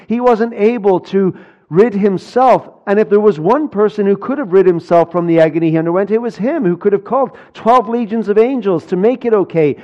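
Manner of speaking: loud, driving sermon climax; preaching at full force. Acted. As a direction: pound out each line about the cross. Preacher's climax on Christ's suffering.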